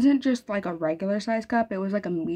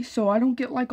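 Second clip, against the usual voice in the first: plain reading voice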